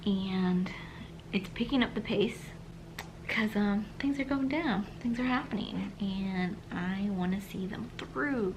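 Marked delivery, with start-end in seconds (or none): 0.1-3.9 soft whispered update. Real, not acted. Vlogger whispering reading update at night. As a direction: whisper so no one wakes.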